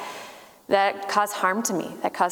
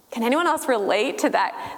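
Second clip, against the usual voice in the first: ordinary talk, nothing marked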